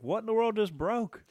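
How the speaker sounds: high, startled retelling of his reaction; startled mock alarm. Husband downstairs hears something shatter, startled and high. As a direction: pitch shoots up in mock alarm.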